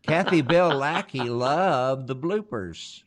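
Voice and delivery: ordinary delivery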